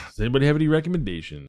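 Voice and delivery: fast, throwaway re-ask at normal pitch; a hurried second ask; rapid repeat. Pretend. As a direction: rattle the same question off quickly. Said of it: "quick repeat of same question".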